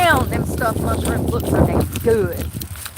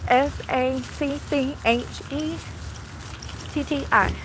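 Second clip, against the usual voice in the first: spelling it out; 4 semitones higher, 4 dB quieter than usual. Real, not acted. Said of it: "Someone help me".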